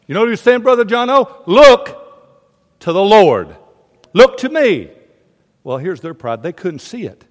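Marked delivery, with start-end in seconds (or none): 1.5-4.9 loud, high, drawn-out exhortation; proclaiming call. Acted.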